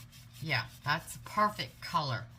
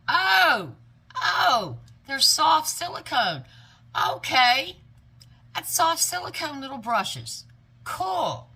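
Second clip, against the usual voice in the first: high, loud squeal of discovery; real excited amazement; delighted surprise; 9 semitones higher, 9 dB louder, slower than usual. Real, not acted. Discovers the bristles are soft silicone.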